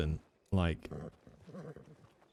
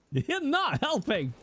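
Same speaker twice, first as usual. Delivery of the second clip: mock exasperated wail; 13 semitones higher, slower than usual. Scolding chat again, more theatrically.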